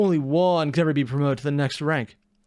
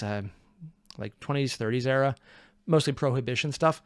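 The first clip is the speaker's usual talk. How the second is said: ordinary talk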